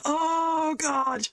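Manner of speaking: high, exasperated groan of a dwarf; a worried barkeep sighing at trouble; dismayed dwarf barkeep. Acted. Will dismayed at Malachi's return.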